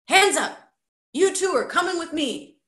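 high, loud command; man's sudden order. A narrator's reading. Armed man ambushing two boys from behind, shouted command. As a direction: bark it out to stop them. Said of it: "a man's voice spoke from behind them".

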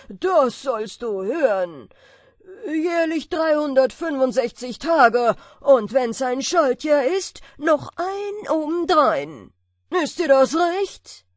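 very loud, high giant bellow; booming giant. A narrator's reading. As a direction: boast it like a huge giant. Giant names the wage. (dröhnende Riesenstimme)

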